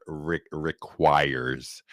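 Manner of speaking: requires said very slowly, stumbling on re-; struggling word. Real, not acted. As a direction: drag out requires carefully. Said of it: "I can't say this word".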